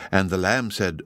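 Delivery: ordinary delivery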